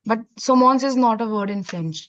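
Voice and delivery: usual speaking voice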